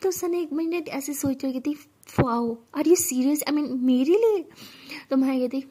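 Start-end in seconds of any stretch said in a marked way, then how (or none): 2.2-4.5 stunned disbelief. A narrator's reading. Girlfriend stunned by confession.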